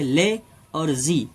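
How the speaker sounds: "ले" और "जी" को धीरे, अलग-अलग बोलें; "ले", ठहराव, फिर "जी"; दो हिस्सों में. Shown as a demonstration. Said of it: "दो हिस्सों में इसे हम". (lazy के सिलेबल तोड़कर: "ले… और… जी")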